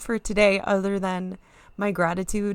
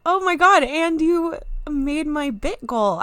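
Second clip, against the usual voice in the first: thrilled; 8 semitones higher, 6 dB louder than usual. Real, not acted. Thrilled at bits reaching goal.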